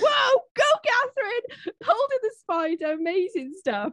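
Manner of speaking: mock cheering. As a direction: cheer Catherine on with exaggerated awe. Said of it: "so I was like".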